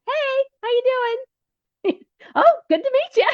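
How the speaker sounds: high, chirpy hello to passers-by; cheery passing greeting. Retold or imitated. Waving at pilgrims as they pass, a high cheery greeting. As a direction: sing out a friendly hey to each walker.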